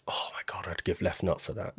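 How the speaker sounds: soft breathless whisper of longing; awed whisper. Retold or imitated. Dreaming of a cozy Swiss chalet, whispered. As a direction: whisper it like you're craving it. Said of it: "I was just like".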